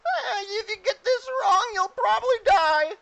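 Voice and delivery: very high, loud goblin squawk; slow, squealed warning from a goblin. Acted. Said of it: "some of the goblins turn to you and go".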